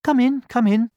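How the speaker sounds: very high, loud hen; hen character. A narrator's reading. Hen welcoming the pig at her door, calling out. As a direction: call them in with a high sing-out. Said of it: "said the hen".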